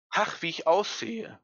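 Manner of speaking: a lightly voiced little ghost, shocked at its mirror image; read-aloud horror. A narrator's reading. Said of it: "rief es entsetzt". (leicht entsetzter Ausruf, höher gesprochen)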